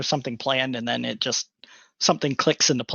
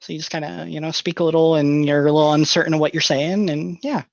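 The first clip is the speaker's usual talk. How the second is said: put-on higher, pitchy nasal voice of an innocent kid; nasally nerd; 4 semitones higher, 6 dB louder than usual. Shown as a demonstration. Showing fellow players a favourite character voice, nasally nerd. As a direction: push it up in the nose, unsure of yourself. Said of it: "You sound like a nerd".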